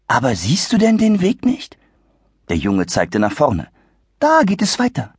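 a hint of a polite boy; gentle boy. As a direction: patiently point the way.

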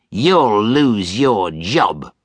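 slow, deliberate threat; menacing. Acted. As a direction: spell out the threat word by word. Scrooge threatens Bob.

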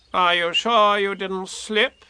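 slow, knowing rabbit voice; wise Rabbit. Acted. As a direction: ask it sagely. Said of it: "asked Rabbit wisely".

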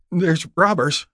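high, gasped warning; frightened gasp. A narrator's reading. Woman explains her shotgun to a passing rider, gasping. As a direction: gasp it out in fear. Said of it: "she gasped".

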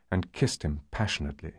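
usual speaking voice

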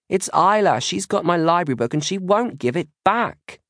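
put-on high-pitched child's protest; shouting back. A narrator's reading. Maisie blaming her sister over the library book, shouting. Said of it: "Maisie shouts back".

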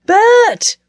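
loud, high-pitched call; glad shout. A narrator's reading. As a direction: shout the name in delighted greeting. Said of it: "he shouted".

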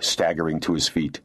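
usual speaking voice

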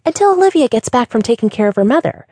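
put-on high, breathy girl jumping in; chirpy receptionist voice; quick bubbly cut-in. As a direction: finish her sentence eagerly. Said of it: "the girl answered quickly".

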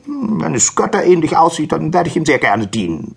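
high-pitched donkey voice; willing, high-voiced donkey; eager donkey. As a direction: offer it keenly, up high. Donkey offers to serve.

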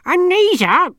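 high, loud cackling crone; bright hopeful granny voice; hopeful old woman. Acted. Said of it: "said Nanny Ogg hopefully".

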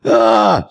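short high scream of fright; very high-pitched startled "ah". A narrator's reading. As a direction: shriek as if seeing the dead move. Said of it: "screamed the others".

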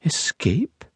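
a high, startled one-word question; shocked disbelief. A narrator's reading. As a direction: gasp it out in disbelief. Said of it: "Her jaw dropped".

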